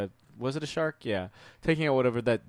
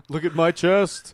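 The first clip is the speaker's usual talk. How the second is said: laughing; 6 semitones higher, 8 dB louder, slower than usual. Pretend. Quoting the film line, laughing.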